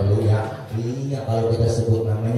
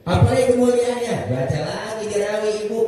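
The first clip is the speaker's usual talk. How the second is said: raised preaching; 10 semitones higher than usual. Acted.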